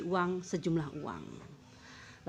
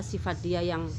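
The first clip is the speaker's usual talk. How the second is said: ordinary delivery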